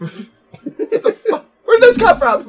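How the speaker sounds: high, loud, baffled cry; baffled outburst. Pretend. A victim finding a mystery object, high baffled yell. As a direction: yelp it out like you just found something strange.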